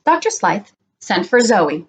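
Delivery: usual speaking voice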